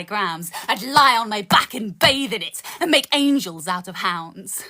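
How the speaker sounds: rhythmic poetry recital with dark punchlines; spoken word poem. Acted. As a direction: perform it like a slam poem.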